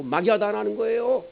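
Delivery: ordinary talk, nothing marked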